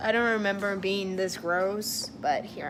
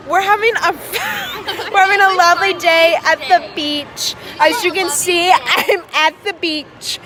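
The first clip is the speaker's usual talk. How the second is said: bright, louder and higher presenter voice, a touch hammed-up; mock vlogger; 7 semitones higher, 8 dB louder than usual. Telling the vlog about the day, mock presenter voice. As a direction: play a cheesy travel vlogger. Friend says tell your vlog.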